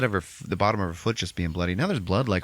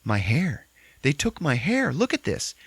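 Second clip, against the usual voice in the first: rising to higher put-on alarm; mock distress; 7 semitones higher than usual. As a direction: build from upset to shrill. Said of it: "she's like".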